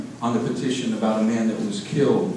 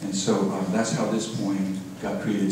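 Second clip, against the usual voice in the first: usual speaking voice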